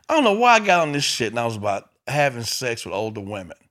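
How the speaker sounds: ordinary delivery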